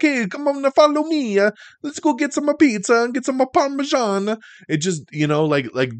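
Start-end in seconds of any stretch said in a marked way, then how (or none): 0.0-4.4 high cartoon Italian accent; playful exaggerated Italian voice. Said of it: "stereotypical Italian".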